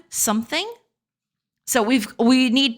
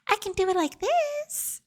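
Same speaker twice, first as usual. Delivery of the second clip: higher feminine voice; 11 semitones higher, 6 dB quieter than usual. Shown as a demonstration. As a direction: voice pushed up, more feminine. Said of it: "Should the voice mod be thicker also".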